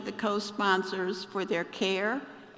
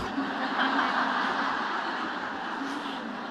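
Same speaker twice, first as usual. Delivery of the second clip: laugh; 6 semitones higher than usual. Real, not acted. Laughs at own joke.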